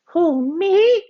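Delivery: put-on high, surprised little boy; kid voice. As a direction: squeak it out in surprise. Avery speaking.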